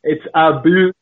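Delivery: teasing imitation of a Canadian accent; mock Canadian accent. Guest teasing the host's speech, mock Canadian accent. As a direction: say "boot" for "about" like a caricature Canadian. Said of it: "Canadian accent".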